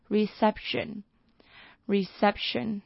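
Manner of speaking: "Reception" said very slowly with the stress on "-cep-"; drawn-out teacher demo of "reception"; slow model pronunciation. Shown as a demonstration. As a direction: drill "reception" for the class. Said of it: "它的名词reception".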